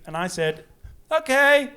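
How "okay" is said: high, loud, mock-panicked reply; flustered answer. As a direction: blurt it out as if caught off guard. Startled answer to the bishop.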